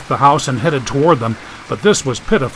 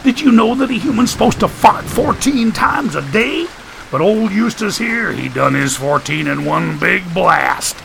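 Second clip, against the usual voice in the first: Staples voice; 6 semitones higher than usual. Acted. Staples folksy trivia.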